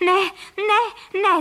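loud, high cries of protest; panicked cry. A narrator's reading. Couple facing guns aimed at their nest, crying out. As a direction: call out in alarm to stop them. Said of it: "zvolali pan Frejka a paní Frejková současně".

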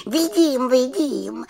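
very high little-witch voice; little witch, eager. A narrator's reading. (vysoký hlásek malé čarodějnice)

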